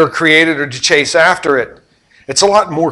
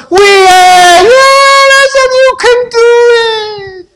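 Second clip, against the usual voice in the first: very high shouted crowd cheer; crowd shouting yes; 9 dB louder, slower than usual. Retold or imitated. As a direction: yell it back like a thrilled crowd.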